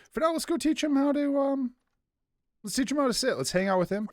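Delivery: high playful pet-owner voice; chirpy high voice for the dog; high sing-song voice. Real, not acted. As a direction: bounce it up high like talking to a puppy. Planning dog training, pet voice.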